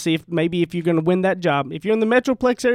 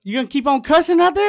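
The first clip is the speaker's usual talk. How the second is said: pastor voice; 9 semitones higher, 4 dB louder than usual. Retold or imitated. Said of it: "he goes".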